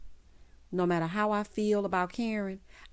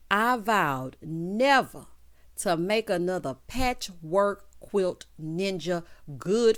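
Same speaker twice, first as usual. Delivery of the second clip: slow emphatic vow; 2 semitones lower, slower than usual. Real, not acted. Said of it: "slow emphatic vow".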